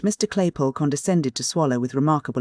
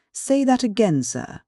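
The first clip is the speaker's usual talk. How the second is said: outraged beadle; 7 semitones higher, slower than usual. A narrator's reading. Beadle bursting in.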